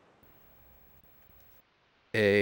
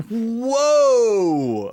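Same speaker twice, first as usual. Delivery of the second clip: loud, high startled whoa; surprised; 12 semitones higher, 7 dB louder than usual. Real, not acted. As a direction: caught off guard by a voice. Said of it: "Was not ready for that voice".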